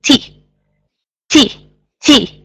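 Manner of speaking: T puffed out with a burst of air; T, spit the air out; breathy T with a burst of air; aspirated T. Shown as a demonstration. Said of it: "the air comes from to the outside".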